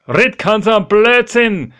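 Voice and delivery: high, loud dismissive call; loud retort. Acted. Petrus calls back to the supposed ghost, loud scornful retort. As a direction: shout the disbelief across the water. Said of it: "und sagt".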